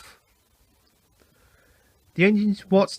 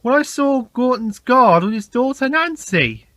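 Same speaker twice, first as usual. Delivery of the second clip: James telling his story; 6 semitones higher, 4 dB louder than usual. A narrator's reading. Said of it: "interrupted James".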